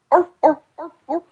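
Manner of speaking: high yapping seal barks; seal barking. Pretend. Mock-angry friend barking like a seal. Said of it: "barking like a seal".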